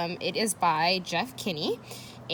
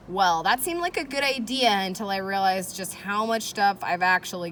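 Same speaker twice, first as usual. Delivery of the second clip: lively storybook read, louder with rising energy; expressive read-aloud for children; reading aloud to kids; 2 semitones higher, 6 dB louder than usual. Acted. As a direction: read it like a teacher hooking the class. Reads diary entry to class.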